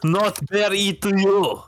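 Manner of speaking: light mock Russian accent, slowing at the end; heavy russian accent menace. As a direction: deliver the Russian bear joke in a hammy Russian accent. Soviet wrestler joke, Russian cliché.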